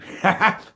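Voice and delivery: a sudden loud, high laugh; high burst of laughter. Real, not acted. Laughing at her own gorgeous joke, loud high laugh. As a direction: crack up at your own vanity joke.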